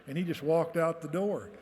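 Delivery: ordinary delivery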